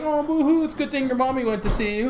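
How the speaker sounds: hammed-up fake pity; mock sympathy. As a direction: tease him like a baby. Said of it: "I was like".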